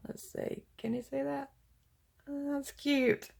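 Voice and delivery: very soft, near-whispered muttering; hushed aside. Real, not acted. Crafter checking if the camera can see, hushed aside. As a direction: drop to an absent-minded murmur.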